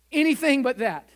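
crying out. Retold or imitated. Pastor retells crying out to God.

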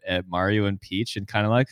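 ordinary talk

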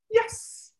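high airy "Yes" marker. Shown as a demonstration. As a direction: chirp the yes way up.

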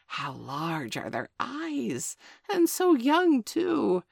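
oily flattery. Acted. Jackal flatters the wolf cubs.

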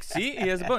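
triumphant. Retold or imitated. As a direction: show off that he has a boat. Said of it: "And he's like".

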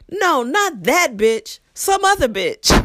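high, slow, loud blurt; not whispering. Retold or imitated. Coworker blurting within the student's earshot, loud and high. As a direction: blurt it out loud for all to hear. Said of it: "he did not whisper".

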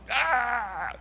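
high imitated yell; a brief high yell, retold; father reenacting his attic fight yell; mock yell.

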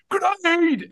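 high, loud battle warning; urgent combat callout; video game soldier shout. Shown as a demonstration. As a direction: yell it like a soldier under fire. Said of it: "Call of Duty sort of stuff".

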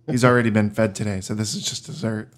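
normal talking voice

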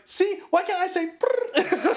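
high, slow comic whine; mock outrage breaking into laughter; pissed-off character. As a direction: protest indignantly, then bleep yourself, half laughing. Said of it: "characters get pissed off".

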